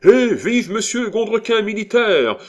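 very high, loud hurrah; yelled cheer. A narrator's reading. Cascadin hails the orator after his speech, yelling. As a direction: yell it over a cheering crowd. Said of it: "Hurla Cascadin".

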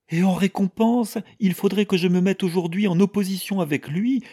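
high, loud, aggrieved outburst; worked-up, high-pitched indignation; indignant protest. A narrator's reading. Mother indignantly defends her husband.

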